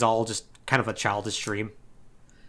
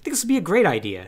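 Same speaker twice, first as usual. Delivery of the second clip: eager Titus; 8 semitones higher, faster than usual. Retold or imitated. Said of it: "And Titus is like".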